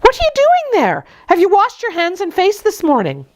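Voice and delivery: high, sharp scolding nursemaid; scolding nurse. Acted. Nurse Bessie scolds young Jane for dawdling, high and sharp. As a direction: scold the child from the doorway.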